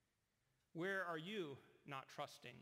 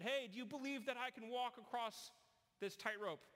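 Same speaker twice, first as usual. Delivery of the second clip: higher, showman calling to the crowd; performer's challenge; 7 semitones higher than usual. Retold or imitated.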